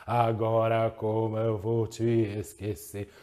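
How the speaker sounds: low, slow raspy smoker's voice; imitating a husky smoker's singing voice; Ana Maria Braga smoker voice. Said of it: "com a voz de fumante".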